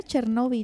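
Chernobyl said as cher-NO-bil, stress on the o; Spanish stress on the o. Shown as a demonstration. Cohost corrects the pronunciation, Chernobyl with stress on NO. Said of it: "Tiene acento en la O".

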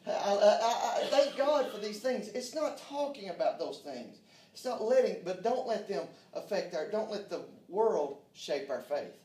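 loud, rousing preacher's cadence, pushing each line; rally the church with a loud, stretched preaching call; preaching at full force. Acted. Preaching to the congregation.

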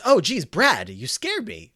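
mildly acted fright, easing off quickly; startled. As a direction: act out a small jump-scare. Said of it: "he is freaked out and jumps".

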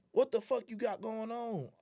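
high-pitched, drawn-out retold challenge; indignant confrontation. Confronting the man who grabbed his arm, indignant. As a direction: act out confronting him, slowly.

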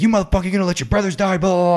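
high, fast, barking rant at a recruit; hammed-up sergeant tearing into a recruit; mock drill instructor yell. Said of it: "be like".

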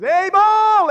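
high, loud, slow declaration; preaching shout. Real, not acted. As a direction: proclaim it with full force. Preaching climax.

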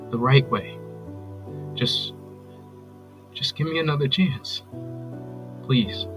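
low, halting apology with long pauses; subdued, contrite voicemail plea; remorseful pleading. Acted. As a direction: beg for another chance, searching for words.

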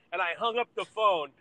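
ordinary talk, nothing marked

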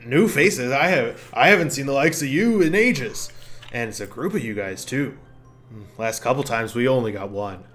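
a lonely mushroom glad of company; gruff mushroom voice. Acted.

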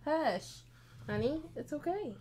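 calm the pup down tenderly; soothing the dog. Real, not acted.

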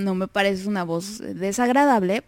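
ordinary delivery

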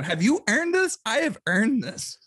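high-pitched, hammed-up entitlement; playful outrage, slowing on the claim; mock indignant challenge. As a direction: challenge an imagined kid, then claim it slowly. Mock speech to imagined children.

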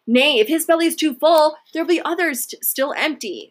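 a greedy neighbor jeers for the meat; guttural Hun. A narrator's reading. Hungry Hun rower taunts Beric, a lightly voiced jeer. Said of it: "the guttural voice of the Hun".

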